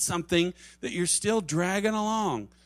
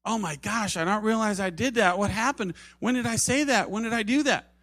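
higher-pitched, flustered questions; mock astonishment; 4 semitones higher, 3 dB louder than usual. Offender answers a hurt friend, mock astonished. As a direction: act taken aback, questions tumbling out. Acted offender surprised at being confronted.

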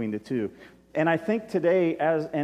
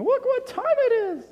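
sudden much higher, louder squeak of protest; high mock complaint; mock impatient listener; 6 dB louder than usual. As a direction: play a fidgety listener checking the clock. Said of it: "for those of you that are thinking".

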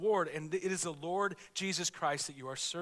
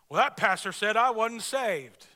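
slow, louder Southern drawl; hammed-up Southern complaint; preacher mimics an offended Southern congregant, slow and loud; Southern accent; 3 semitones higher, 9 dB louder than usual. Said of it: "Especially if you have a Southern accent".

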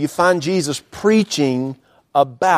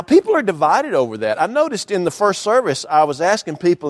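ordinary delivery

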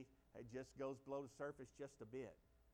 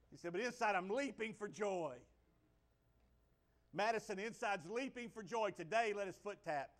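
raised, louder preaching, building with joy; full-force preaching; 6 semitones higher, 9 dB louder than usual. Acted. Pastor rousing the church about celebrating, preaching at full force. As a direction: preach it high and loud to the congregation.

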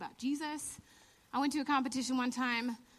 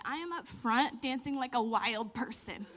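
ordinary delivery

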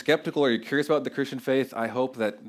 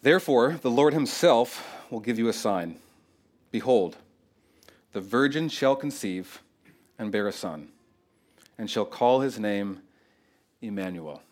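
scripture reading. Acted. Reading scripture text aloud.

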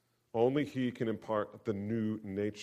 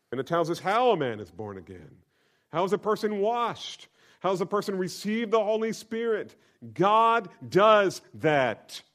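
fiery preaching; 10 semitones higher, 9 dB louder than usual. Acted. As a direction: drive it home to the congregation. Preacher builds to full force.

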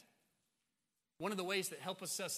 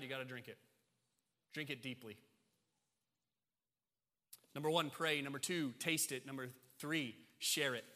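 ordinary talk

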